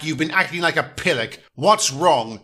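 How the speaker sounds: ordinary talk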